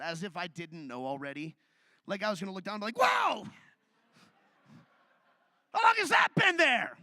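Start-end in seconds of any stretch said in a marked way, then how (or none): 3.0-7.0 high, loud, hammed-up shock; mock surprise. Comedian faking shock at his own belly. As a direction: gasp as if spotting it for the first time.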